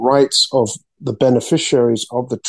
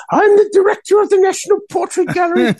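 mock shrieky Australian accent, pitched way up; high-pitched Australian accent; 3 dB louder than usual. Frustrated museum director rejecting an artist, high-pitched Australian accent. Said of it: "in her high-pitched Australian accent".

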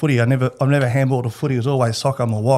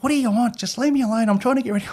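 much higher, whiny imitation of his fiancée; mock fiancée voice; 10 semitones higher, faster than usual. His fiancée, interrupted while getting ready, in a high whiny imitation. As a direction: pitch it way up like an annoyed partner getting ready. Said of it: "and she's like".

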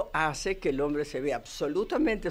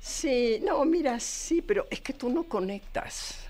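complaining woman voice; 6 semitones higher than usual. Acted.

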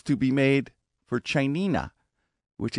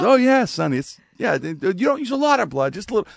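higher, lightly voiced old grandma; a breezy old lady brushing it off; grandma's casual answer; 10 semitones higher, 4 dB louder than usual. Grandma tells her grandson about the blood, breezy and high.

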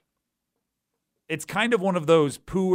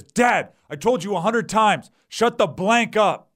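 put-on angry yelling kid; 5 semitones higher, 5 dB louder than usual. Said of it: "Kid runs up into the stands and says".

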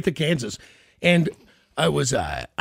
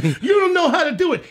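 high, laughing singsong taunt; mocking coworker taunt; 9 semitones higher, 4 dB louder than usual. Retelling a factory coworker who taunted him, high mocking voice. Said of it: "He's like".

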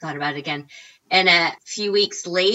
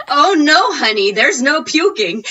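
higher, louder, laughing mock-chipper host; host's reassurance; 9 semitones higher, 8 dB louder than usual. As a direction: brightly brush off the nerves. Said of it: "she goes".

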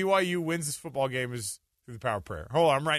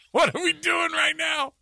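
high, slow, loud disbelieving yell; incredulous shout; 13 semitones higher, 3 dB louder than usual. Real, not acted. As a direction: throw it up in exasperation. Host repeats it, shouting.

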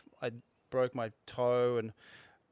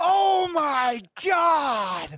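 high, slow, drawn-out shout; imitating Kelly's yell; 9 dB louder, much slower than usual. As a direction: belt it out like a yell across the lineup. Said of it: "Kelly just yelled out".

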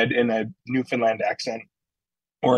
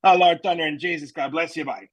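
put-on Newfoundland accent; 4 semitones higher than usual. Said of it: "a Newfoundland saying that goes".

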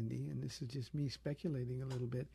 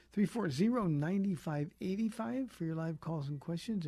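louder, higher announcer read of the number; radio call-in plug; 4 semitones higher, 4 dB louder than usual. Acted. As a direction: sell the phone line like a DJ. Radio host gives call-in number.

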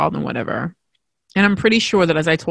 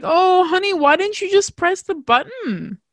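high, sugary patronizing voice; condescending nurse; 12 semitones higher than usual. Retold or imitated. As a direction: talk down to her like a child. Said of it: "she's like".

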